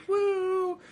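high whoop of celebration; excited whoop. Real, not acted. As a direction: cheer it out. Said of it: "Throwback to episode three".

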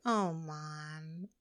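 a lightly voiced, loving little "Oh, Mom". Daughter comforting her crying mother, a touch of tenderness. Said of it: "in, like, this loving way".

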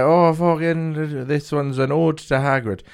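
put-on slow, drawn-out drunken Irish announcing; hammed-up slurry Irish lad; drunk Irish ditty intro. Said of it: "He's doing little songs about it".

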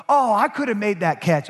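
mock boast. Mocking boastful fans.

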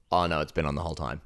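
ordinary talk, nothing marked

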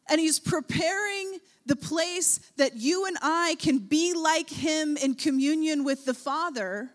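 normal talking voice

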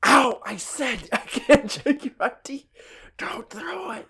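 high hushed cry in the dark; whisper shout. Pretend. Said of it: "that whisper shout".

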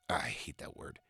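a quick unvoiced whispered gripe; whispered aside. Real, not acted. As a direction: whisper it under your breath. Aside about the word woke.

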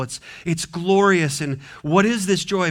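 normal talking voice

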